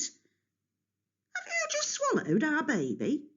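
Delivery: high, slow, horrified question; can't believe what he did; shocked mother. A narrator's reading. Rhea shocked at Kronos.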